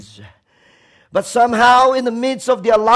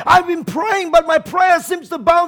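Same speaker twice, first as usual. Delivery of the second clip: raised, pitched-up lament at God; abandoned cry; 8 semitones higher, 3 dB louder than usual. Retold or imitated. Preacher voicing despair to God on Good Friday, raised. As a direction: cry out to God as one forsaken. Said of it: "it's like".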